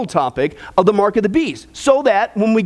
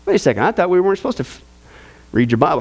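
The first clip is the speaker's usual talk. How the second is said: ordinary delivery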